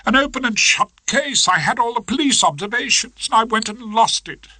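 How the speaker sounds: lightly voiced Miss Trant, distressed; young barrister giving up, upset; doleful young barrister.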